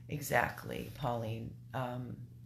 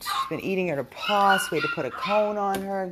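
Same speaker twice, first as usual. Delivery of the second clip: ordinary delivery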